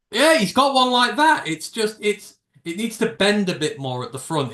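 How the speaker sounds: high loud excited gushing. Real, not acted. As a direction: gush about the hat. Excited about the hat.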